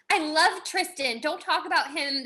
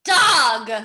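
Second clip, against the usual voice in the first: loud; 8 dB louder than usual. Acted. Outburst at end of line.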